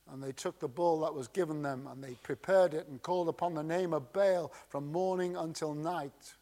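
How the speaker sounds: hushed, hurried scripture reading; quiet quick reading. Shown as a demonstration. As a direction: read the verse quickly and quietly as an aside. Second reading of verse twenty-six.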